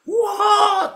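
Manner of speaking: sudden high yelp of fright; shrill frightened shout; startled yell. Retold or imitated. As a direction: cry out as if jolted awake by a nightmare. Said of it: "teriak begitu ya".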